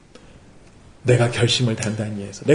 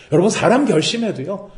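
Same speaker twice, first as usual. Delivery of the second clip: normal talking voice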